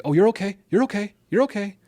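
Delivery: high, loud sing-song "you're okay" brushing the hurt aside; a parent hurrying to calm a fallen child; dismissive parent reassurance. Retold or imitated. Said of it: "you'll hear parents say".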